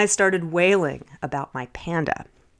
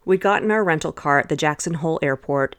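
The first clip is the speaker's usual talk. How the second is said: ordinary talk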